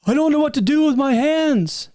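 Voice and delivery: mock a stage-frozen executive; awkward presenter. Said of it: "all the people were just".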